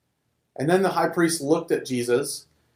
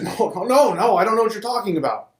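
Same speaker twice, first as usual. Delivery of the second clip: higher, louder, fast no no no; panicked denial; 7 semitones higher, 4 dB louder than usual. A narrator's reading. Peter denying to the accusing man, panicked and fast.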